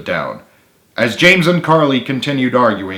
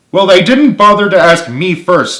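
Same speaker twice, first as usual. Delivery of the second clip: higher, louder snap; snapped; 8 semitones higher, 7 dB louder than usual. A narrator's reading. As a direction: snap it back at him. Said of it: "snapped Carly".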